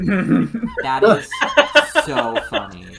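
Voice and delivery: laughing through the words. Real, not acted. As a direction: laugh out the line, slowly. Laughing at a funny message.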